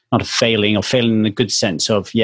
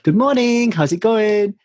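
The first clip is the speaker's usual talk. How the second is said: cheery greeting; 10 semitones higher, slower than usual. Retold or imitated.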